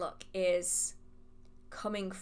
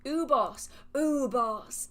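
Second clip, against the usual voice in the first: loud, raised-pitch burst on the name; aggressive outburst; 5 semitones higher, 6 dB louder, slower than usual. Real, not acted. Host suddenly remembering a TV show title, aggressive blurt. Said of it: "Sorry, that was really aggressive".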